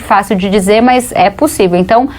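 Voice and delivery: ordinary talk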